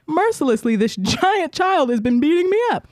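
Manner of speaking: high, hammed-up complaint; imitated tattling child. Kid tells mom about the bully, melodramatic. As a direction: pile on the mock victimhood in a high voice.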